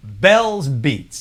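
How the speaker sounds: 힘차게 코너 제목을 외치듯; segment title announcement. Acted. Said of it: "a new segment that I like to call".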